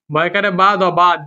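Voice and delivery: normal talking voice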